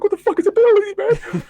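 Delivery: loud, high-pitched panicked yell; squealed, alarmed outburst; retold freaking out. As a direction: act out frantic confusion, voice shooting up. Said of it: "I was really freaking out".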